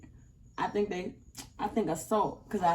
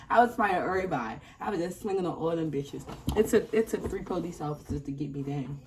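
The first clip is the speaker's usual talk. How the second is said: ordinary talk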